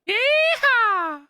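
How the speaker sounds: whooping hillbilly yeehaw, high and loud; big put-on country whoop. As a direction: holler it like a rodeo cowboy. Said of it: "What's the matter with my accent".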